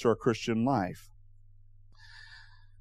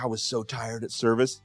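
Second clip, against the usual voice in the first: quiet quote; 3 semitones lower, 6 dB quieter than usual. Retold or imitated. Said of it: "He's like".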